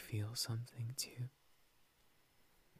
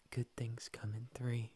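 normal talking voice

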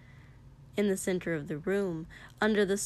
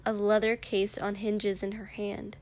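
ordinary delivery, nothing marked

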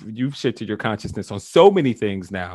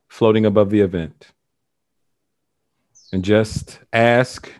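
guided meditation; 4 semitones lower than usual. Acted. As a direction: lead them gently down the timeline. Guides the session with long pauses.

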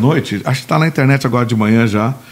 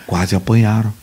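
ordinary talk

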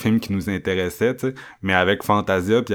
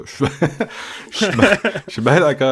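higher, quick, through laughter; amused agreement; 8 semitones higher, faster than usual. Real, not acted. Co-host agreeing with his friend's point, laughing.

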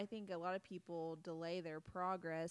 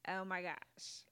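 put-on Valley Girl voice; slower than usual. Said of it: "Valley Girl voices".

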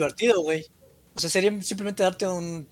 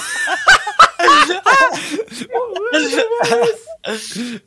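high, loud crying laughter; helpless laughing fit; wheezing laugh; 7 dB louder than usual. Real, not acted. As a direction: break down laughing till it squeaks. Bursts out laughing at own joke.

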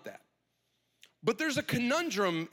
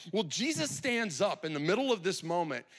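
ordinary talk

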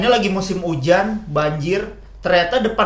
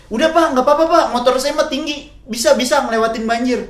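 higher-pitched, insistent brush-off; teman ngeyel; 6 semitones higher than usual. Retold or imitated. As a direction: imitate a stubborn friend, pitched up. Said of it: "Temen gue ada yang ngeyel". (ngeyel dengan nada tinggi)

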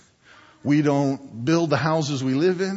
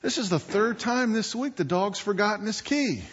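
higher-pitched acted complaint; exasperated owner; 7 semitones higher than usual. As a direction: grumble back at the onlooker, voice raised in pitch. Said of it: "looked at him and said".

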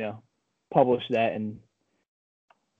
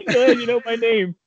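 prank caller; 8 semitones higher than usual. Retold or imitated. Voicing the prank caller's reply.

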